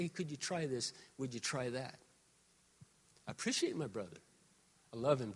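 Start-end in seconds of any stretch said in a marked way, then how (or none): 0.0-2.0 soft, gentle request; lovingly. Retold or imitated. Pastor gently asking the sound guy, soft and kind. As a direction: ask kindly, much softer. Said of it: "lovingly say".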